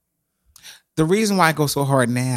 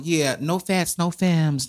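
ordinary talk, nothing marked

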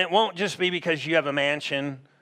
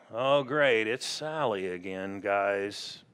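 low, quiet mock-disgruntled host; dry, lowered voice of put-upon suspicion; weary dread; 6 semitones lower, 5 dB quieter than usual. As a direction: grumble under your breath about an unwelcome guest. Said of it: "And God's not thinking like".

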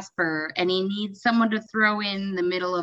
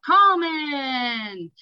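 slow drawn-out crowd chant; echoing stadium announcer; 6 semitones higher, much slower than usual. Retold or imitated. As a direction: stretch the name like a stadium echo. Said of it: "and we all go".

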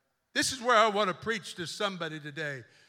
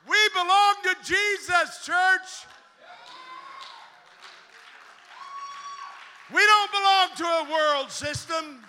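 high, loud, fervent preaching cry; full-force preaching; 5 semitones higher, 6 dB louder than usual. Acted. Preacher rallies the church as the bride of Christ, shouting. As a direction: preach it out to the back row, building to a shout.

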